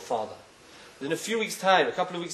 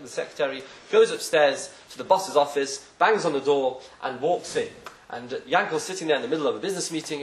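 ordinary talk

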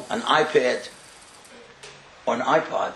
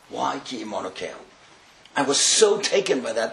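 ordinary talk, nothing marked

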